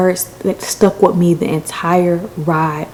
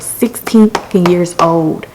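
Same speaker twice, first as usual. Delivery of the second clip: ordinary delivery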